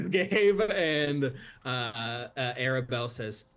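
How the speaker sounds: usual speaking voice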